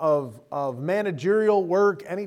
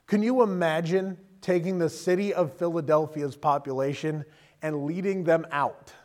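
ordinary delivery

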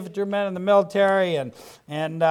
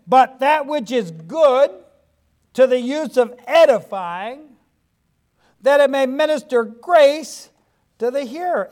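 scripture reading; 4 semitones higher, 4 dB louder than usual. Acted. Reads scripture aloud.

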